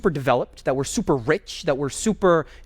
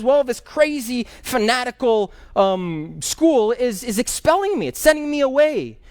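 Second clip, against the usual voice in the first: put-on higher, aggrieved voice of a young man near tears; upset student; 6 semitones higher, slower than usual. Expelled student complains to the teacher, higher and tearful. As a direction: plead that the school is throwing you out. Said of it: "he's crying inconsolably".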